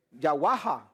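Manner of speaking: Yawaha said as ya-WA-ha; "Yawaha" ending in a breathed ha; Yawaha pronunciation variant. Shown as a demonstration. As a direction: say Yawaha with open a vowels throughout. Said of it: "Hay personas que lo, dicen".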